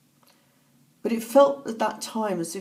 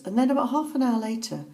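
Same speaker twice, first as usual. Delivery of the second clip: usual speaking voice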